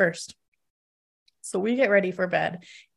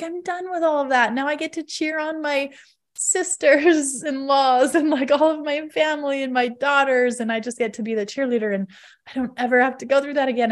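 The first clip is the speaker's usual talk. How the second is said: higher and bright, rushing at the end; happily free of it; cheerful relief; 6 semitones higher than usual. Retold or imitated. Retold relief, cheerleader role.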